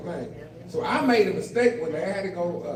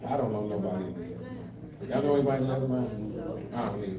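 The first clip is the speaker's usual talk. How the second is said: much lower, softer, quick; dropped low throwaway; low aside; 11 semitones lower, 6 dB quieter, faster than usual. Real, not acted. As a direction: mutter it aside to the crowd.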